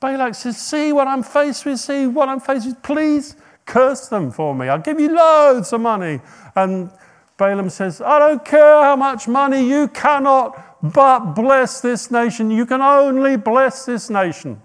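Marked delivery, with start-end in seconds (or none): 0.6-6.2 pleading king. Retold or imitated. Balak pleads with Balaam. 8.0-14.6 high, loud, slow insistence; louder, pitched up, unbending; firm loud refusal. Retold or imitated. Balaam refuses money firmly.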